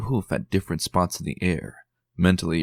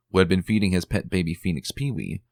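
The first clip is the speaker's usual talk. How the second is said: ordinary delivery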